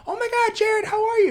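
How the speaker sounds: very high gushing greeting; gushing fan voice. Retold or imitated. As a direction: squeal hello like an overeager fan. Imitating strangers greeting him.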